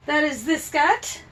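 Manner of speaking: goofy cartoon SpongeBob accent, half unaware. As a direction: lightly slip into a SpongeBob voice. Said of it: "I don't know why I'm doing that accent".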